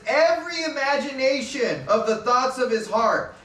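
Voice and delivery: "Every" hit hardest at the top of the line; stress on every. Shown as a demonstration. Preacher reading scripture with weight on "Every". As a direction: lean on "Every" and let the rest fall. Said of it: "Emphasis on the word every".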